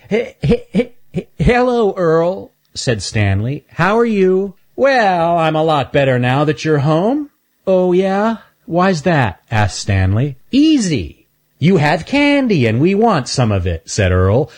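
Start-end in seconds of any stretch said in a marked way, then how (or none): 0.1-2.5 high, halting stammered greeting; nervous stuttering hello; Stanley's stutter. Acted. Stanley stammers a greeting to Earl, high and halting. 3.7-9.4 high, lively critter voices; cartoon animals chatting; character voices. Stanley and Earl chat, in high cartoon voices. 10.5-13.9 high, cheeky critter voice; a sly bully asking for candy; Earl's voice. Acted. Earl tells Stanley they want his candy, high and cheeky.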